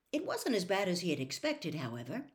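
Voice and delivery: ordinary delivery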